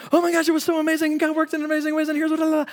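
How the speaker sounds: high, fast, gushing yell; excited yelling. Retold or imitated. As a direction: yell it over the shower curtain, bursting with news. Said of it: "I just start yelling over the shower curtain".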